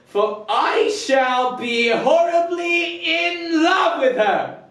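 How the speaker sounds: exultant high cry, drawn out; Benedict. Acted. Benedict declaring he will be in love with her, high exultant proclamation. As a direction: proclaim love in a big theatrical shout. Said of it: "Benedict's monologue".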